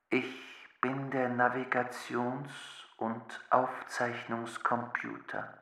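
soft, slow, measured machine voice; talking computer. Acted. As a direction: explain slowly like a patient machine. Computer introduces itself.

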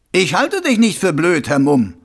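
high, loud mocking voice; a smug killer playing innocent; sneering villain. A narrator's reading. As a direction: Carcer mocking the watchman. Karzer taunts.

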